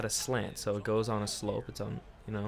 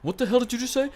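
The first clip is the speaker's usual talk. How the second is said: loud, raised-pitch angry demand; pissed-off dad; 13 semitones higher, 6 dB louder, faster than usual. Retold or imitated. Imitating the friend's dad confronting his son, loud and angry. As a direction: challenge him like an outraged father. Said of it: "his dad got so pissed at him".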